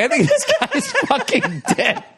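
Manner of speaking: high, laughing quote; half-laughing shocked remark; laughing disbelief. Retold or imitated. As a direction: say it while cracking up. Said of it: "I was like".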